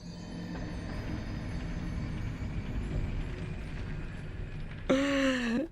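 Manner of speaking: high, silent wheezing laugh, breath only. Real, not acted. Gamer wheezing with laughter at a mimic. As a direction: laugh until no sound comes out.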